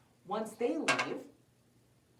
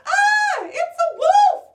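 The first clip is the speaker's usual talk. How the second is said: very high, loud screams for help; panicked screaming; 17 dB louder, slower than usual. Acted. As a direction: scream like a terrified little girl. Girl screams to hunter.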